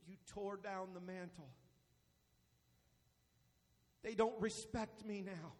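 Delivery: very low, soft, heavy pauses; subdued, grave pastoral admission; hushed confession. Real, not acted. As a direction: drop almost to nothing and confide it.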